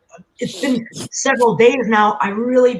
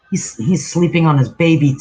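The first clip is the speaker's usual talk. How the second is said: ordinary talk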